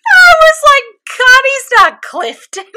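very high, drawn-out protest; squealed confusion. Real, not acted. Confused co-host after misunderstanding, high squealed protest. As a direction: squeal it slowly in amused disbelief.

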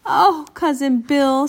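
high, slow, drawn-out exclamation; little cousin's voice. A narrator's reading. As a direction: exclaim like a little girl being rescued. Little cousin greets her rescuer.

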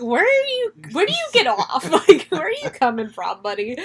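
high, exasperated disbelief; mock outrage at the snooty father; incredulous. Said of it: "That's the thing that I'm like".

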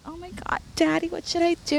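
put-on high breathy little-girl plea; baby voice. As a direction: coo it like a baby-voiced schoolgirl. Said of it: "I'll talk in a baby voice".

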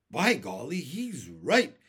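loud, bouncy exclamation of surprise; swooping, punched-out outburst; excited cry. A narrator's reading. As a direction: cry out as the centipede, suddenly won over. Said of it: "cried the centipede".

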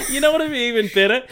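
loud, fast, high, laughing; laughing excitedly. Real, not acted. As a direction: blurt it out laughing.

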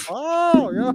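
a very high, sung-out oh yeah; mock impressed oh. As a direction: ham up the impressed reaction. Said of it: "They're like".

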